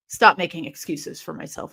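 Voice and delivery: normal talking voice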